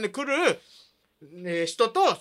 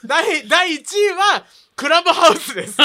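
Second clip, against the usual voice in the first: slow, mock-grand announcement, cracking up; trying not to laugh; 7 semitones higher, 8 dB louder, slower than usual. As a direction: announce it straight but giggle. Solemn ranking reveal, laughter bursts out. (笑いをこらえながらの発表)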